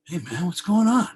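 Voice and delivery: high, loud, upbeat phone greeting. Retold or imitated. Rock star greets a caller on the phone, super upbeat. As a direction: imitate a delighted, super upbeat hello. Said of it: "he's, like, super upbeat".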